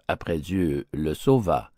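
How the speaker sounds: plain reading voice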